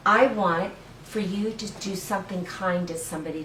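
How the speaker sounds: ordinary delivery, nothing marked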